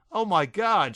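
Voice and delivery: high and loud hysterical cry; shrieked. A narrator's reading. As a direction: shriek it in shock. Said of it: "she shrieked".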